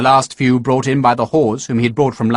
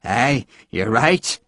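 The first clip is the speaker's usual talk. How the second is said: put-on drunkenly; much slower than usual. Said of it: "Harper said drunkenly".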